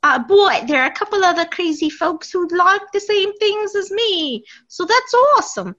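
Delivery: put-on a light New York accent, half in jest. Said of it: "your New Yorking comes out there".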